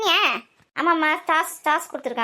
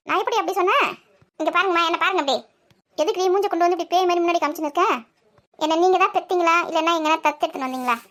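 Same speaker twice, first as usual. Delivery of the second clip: ordinary talk, nothing marked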